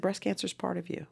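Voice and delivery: soft, whispery, earnest retelling; hushed insistence. Retold or imitated. Stranger to breast cancer patient, hushed and earnest. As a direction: say it softly, almost whispering.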